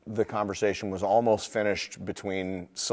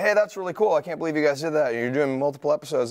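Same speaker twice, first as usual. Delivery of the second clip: excited, higher fan gush; impressed fan; 6 semitones higher, 3 dB louder than usual. Retold or imitated. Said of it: "people are like".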